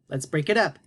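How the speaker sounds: normal talking voice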